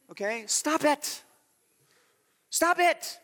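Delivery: high, loud scolding repeat; stern stop it. Real, not acted. Preacher scolds the congregation, high and loud. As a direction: tell them off, firmly.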